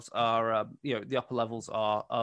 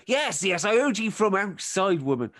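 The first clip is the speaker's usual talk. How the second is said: louder, higher put-on farmer voice; exasperated husband; 7 semitones higher, 4 dB louder than usual. Farmer answers his shouting wife on entering, exasperated. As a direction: answer a nagging wife impatiently.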